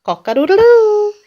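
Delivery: high, loud crowing; rooster crow. A narrator's reading. Reader shows a child the rooster, high loud crow. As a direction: crow like a rooster, long last note. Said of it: "He can sound like a rooster".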